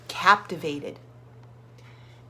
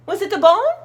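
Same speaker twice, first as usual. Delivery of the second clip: high, louder call to the bird; dog calling up to the bird, higher and louder; 11 semitones higher, 9 dB louder, faster than usual. Acted. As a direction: call up into the tree. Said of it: "he called to the bird".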